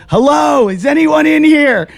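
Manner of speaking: high, loud mock yell. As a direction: shout into the empty store. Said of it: "I'm yelling and screaming".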